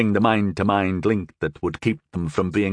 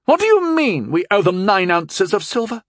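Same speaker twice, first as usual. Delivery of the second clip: sharp, higher demand; 12 semitones higher, 4 dB louder than usual. A narrator's reading. Lady of the manor demanding an answer from her servant, sharp. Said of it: "she was demanding".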